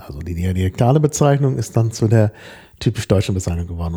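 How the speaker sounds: ordinary talk, nothing marked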